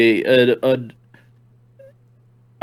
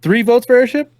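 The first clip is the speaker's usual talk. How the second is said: rising excited count; 9 semitones higher than usual. Real, not acted. Tallying votes, rising.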